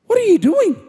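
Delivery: high-pitched, swooping question; incredulous mock question. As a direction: ask it in exaggerated high disbelief. Mock questioning himself in the story.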